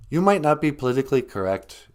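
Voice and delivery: ordinary talk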